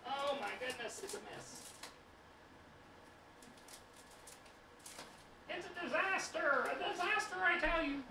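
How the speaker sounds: very high, theatrical dismay; dramatic falsetto. Acted. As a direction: wail it like a fussy dame. Dramatic falsetto over kitchen mess.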